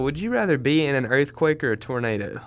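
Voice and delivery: ordinary talk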